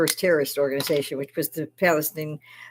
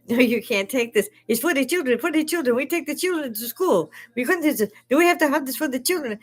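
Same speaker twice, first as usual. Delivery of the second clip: high, whiny mock pleading, higher and higher; mocking the daycare guy; 8 semitones higher than usual. Mimicking the daycare worker defending the van, mock whiny. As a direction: play the flustered worker guarding his door. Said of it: "the guy is like".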